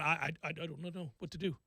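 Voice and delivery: low, hushed and helpless; defeated murmur over the ball; lost golfer. Retold or imitated. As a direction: mumble it like you've lost your swing. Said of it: "looking at the ball, going".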